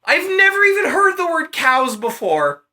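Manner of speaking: can barely get it out for laughing. Real, not acted. Laughing hard at her own telling of the myth.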